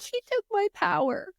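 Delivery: high-pitched child impression; little daughter's voice. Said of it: "And she'll be like".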